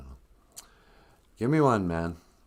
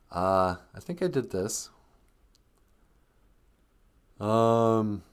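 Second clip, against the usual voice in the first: normal talking voice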